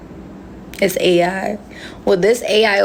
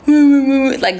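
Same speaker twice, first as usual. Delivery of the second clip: slow, drawn-out mocking voice, louder; 5 semitones higher, 5 dB louder than usual. Shown as a demonstration. Showing viewers how she mocks her man. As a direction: stretch it out like you're mocking him. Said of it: "make my voice like that".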